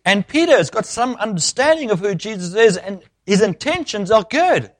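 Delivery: high-pitched, insistent sermon build; impassioned preaching. Acted. Preacher declaring Satan opposes all good, impassioned preaching. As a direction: hammer the repeated words to the congregation.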